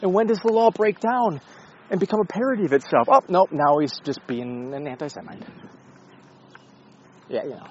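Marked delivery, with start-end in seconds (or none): 0.0-3.1 high, loud mocking voice of an impressed fan; high, loud mock praise, faster at the end; mock admiring onlooker. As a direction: gush over the thinker as a sarcastic fan. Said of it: "It's like".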